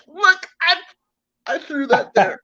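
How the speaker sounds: high, innocent-sounding plea, half laughing; mock innocent. Mock innocent excuse to cops.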